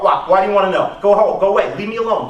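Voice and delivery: usual speaking voice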